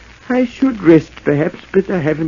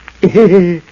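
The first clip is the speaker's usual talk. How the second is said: mad laugh; 4 dB louder than usual. Acted. Madman hearing voices.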